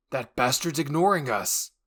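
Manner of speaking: loud furious shout; Jean yelling in outrage at the ignoring titan; angry yell. Acted. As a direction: spit it out in outrage. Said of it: "Jean yelled".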